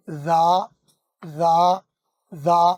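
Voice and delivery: जा held with the throat vibrating; "जा" said with a buzz you can feel in the throat; voiced buzz. Shown as a demonstration. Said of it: "अब आप आवाज़ दीजिए".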